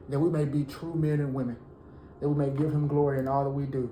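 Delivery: ordinary delivery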